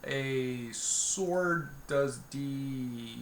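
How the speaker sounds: usual speaking voice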